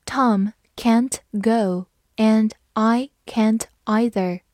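"Tom can't go and I can't either" read slowly; slow it down word by word. Shown as a demonstration. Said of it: "慢慢来读".

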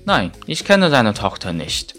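ordinary talk, nothing marked

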